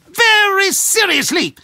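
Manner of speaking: "Very" hit high and loud, then falling; German accent, "Very" punched; emphatic repeat. Acted. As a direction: insist hard on "Very seriously", pitch jumping up on "Very". Insisting games are serious.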